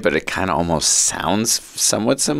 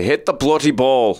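Irish dad; 4 semitones higher than usual. Retold or imitated. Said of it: "imitate him because we loved how he said it".

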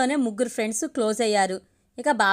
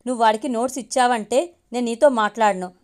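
usual speaking voice

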